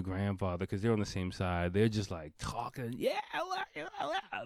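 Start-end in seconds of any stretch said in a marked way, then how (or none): 3.0-4.4 high-pitched mock drunken babble; going crazy. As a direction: imitate rowdy men babbling wildly. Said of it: "they're just going crazy".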